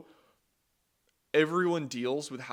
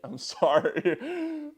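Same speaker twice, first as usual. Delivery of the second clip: sorry squeezed out through a high laugh; laughing apology; 12 semitones higher, 9 dB louder, much slower than usual. Real, not acted. Apologizing for a joke, said high through a laugh.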